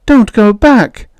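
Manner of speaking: high, loud desperate plea; hysterical pleading. A narrator's reading. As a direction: beg frantically at a high pitch. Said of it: "You're hysterical".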